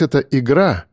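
ordinary delivery, nothing marked

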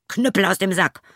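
hoher, lauter Zauberbefehl; befehlender Ruf. A narrator's reading. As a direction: den Knüppel laut herausrufen. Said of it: "so sprach er". (lauter Befehlsruf)

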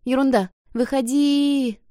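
high call, last word long drawn out; echoing cry. A narrator's reading. Kokoro pulling Aki out, drawn-out cry. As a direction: call out and stretch the final word. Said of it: "Её голос разбился на эхо".

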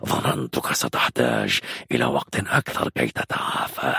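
low, breath-heavy villain voice; sinister guard captain, coolly mocking; menacing commander. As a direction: taunt the prisoner in a low, rasping half-whisper.

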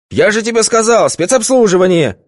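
high, loud scolding; irritated yell. A narrator's reading. Boss scolding Vitka about the private party, irritated yell. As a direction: scold the underling sharply.

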